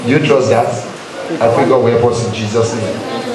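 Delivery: ordinary talk, nothing marked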